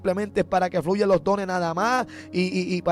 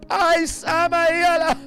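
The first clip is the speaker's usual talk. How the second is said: higher, louder ecstatic tongues; fervent glossolalia, raised and louder; speaking in tongues; 7 semitones higher, 3 dB louder, slower than usual. Real, not acted. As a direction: let the tongues burst out high. Preacher bursts into tongues.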